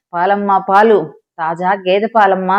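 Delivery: plain reading voice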